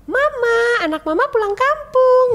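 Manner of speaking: high, loud, sing-song announcement; bright cheery call. Retold or imitated. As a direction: burst in cheerfully to hide the hurt. Cheerful greeting to her mother.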